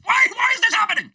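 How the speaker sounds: high, loud, disbelieving yell; real outrage bursting out at the top of the voice; exasperated shout. Real, not acted. Exasperated rant about Israel, Trump.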